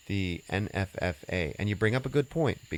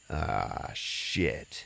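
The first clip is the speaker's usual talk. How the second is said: pained groan; 5 semitones lower, 5 dB quieter than usual. Retold or imitated. Wounded man noticing bleeding.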